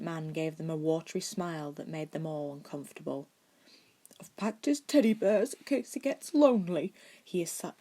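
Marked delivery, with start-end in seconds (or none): 4.2-6.9 tearful whisper. A narrator's reading. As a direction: whisper it as if about to cry. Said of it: "he assured them tearfully".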